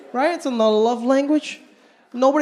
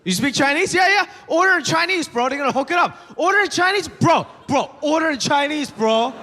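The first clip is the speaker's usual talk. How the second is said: higher-pitched, egging a friend on; imitating over-keen friends; eager pestering; 5 semitones higher than usual. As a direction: pester your buddy excitedly. Friends pestering him, acted out.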